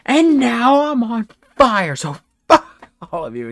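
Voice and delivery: hammed-up dying defiance, dropping off at the end; defiant last cry. Burning villain's last defiant cry.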